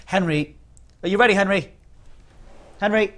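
loud, high call for Henry. Pretend. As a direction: holler as if summoning a helper. Calling Henry to get ready.

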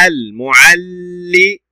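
المعلم drawn out slowly, ending on a separate mu; syllable demonstration. Shown as a demonstration. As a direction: hold the double l in المعلم, then add mu. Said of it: "بالضم، مو".

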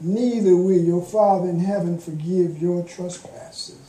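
normal talking voice

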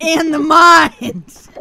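exasperated. Real, not acted. Repeating directions to her confused friend, slow and exasperated. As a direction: spell it out as if for the tenth time.

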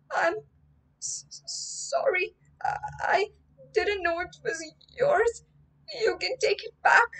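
high, stammering and near tears; a scared boy stammering an apology; stammering fear. Acted. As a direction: stumble over the words in fright. Frightened boy stammers to ghost.

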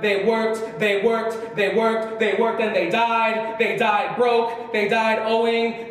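rhythmic, insistent litany; spoken-word poetry. Acted. Poet mourning Puerto Rican workers who died, insistent spoken word. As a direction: recite it as a relentless, building roll call.

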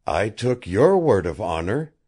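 a touch of dry sarcasm; mock the promise lightly; sarcastic. A narrator's reading. Prisoner Jean needles his captor, mildly sarcastic. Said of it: "said Jean sarcastically".

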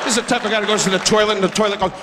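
ordinary talk

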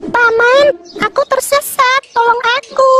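high, drawn-out theatrical wail; plaintive cry for help. Acted. Lost kid begging Paman for help, plaintive wail. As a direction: call out pleadingly, stretching the words high.

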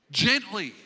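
loud, punched GENTLY; emphatic call-out. Shown as a demonstration. Preacher giving the congregation the answer from the verse, emphatic call-out. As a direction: call out GENTLY firmly as the key word. Louder take, then a soft one.

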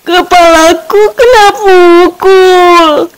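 slow, high, loud wail; drawn-out cry of a struck story character; pained yell. A narrator's reading. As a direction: wail out that you've been hurt. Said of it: "teriak temannya yang lain".

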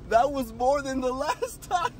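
high, slow words through laughter; breathless laughing remark. Real, not acted. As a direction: talk through the giggles. Laughing after the scare.

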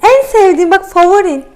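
high, loud, eager pet talk; excited coaxing. Real, not acted. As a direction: talk up a treat to a reluctant cat. Owner coaxing cat with treat. (heyecanla, tiz sesle ikna etme)

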